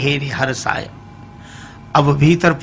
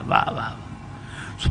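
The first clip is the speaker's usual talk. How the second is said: breathy, barely voiced whispered wah; hushed admiring wah; 7 semitones lower, 16 dB quieter, much slower than usual. Man admiring his reflection, lost in a soft whisper. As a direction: sigh out the praise, almost soundless.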